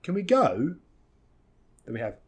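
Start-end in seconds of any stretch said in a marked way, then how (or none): 0.0-0.8 quick weak "can we", long gliding GO; higher pitch, stretched "go"; diphthong on GO. Shown as a demonstration. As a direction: glide through the vowel of GO. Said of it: "move the mouth a lot".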